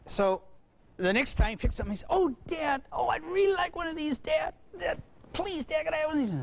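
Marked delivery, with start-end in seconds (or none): 2.1-6.3 high, whiny, near-crying plea; guilt-tripping kid. Retold or imitated. Boy guilt-tripping his dad, high and whimpering. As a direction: whimper to make him feel guilty. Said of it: "He decided to go for guilt".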